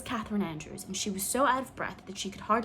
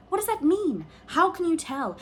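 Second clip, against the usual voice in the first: much higher, louder bewildered questions; baffled; 9 semitones higher, 7 dB louder than usual. A narrator's reading. Mister Blair to the girls, utterly baffled. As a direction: throw up his hands over the recipe. Baffled man over recipe.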